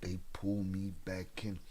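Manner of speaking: light Godfather impression, sinking low; movie quote that slows and quietens at the end; Al Pacino. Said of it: "pulling me in like Al Pacino".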